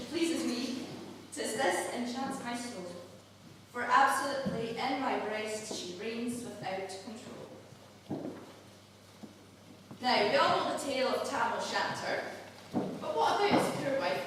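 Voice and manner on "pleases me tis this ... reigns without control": soft, measured Scots verse reading; poem recital. Acted. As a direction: recite it tenderly, line by line. Recites a Burns love poem.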